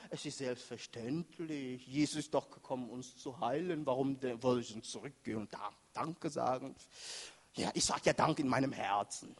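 low, soft thinking to oneself; acted self-excusing thought, kept low; dismissive inner reasoning. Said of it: "Vielleicht haben sie auch gedacht".

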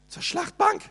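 high, incredulous rise on Schlachtbank; as if hearing it for the first time, astonished; shocked question. Real, not acted.

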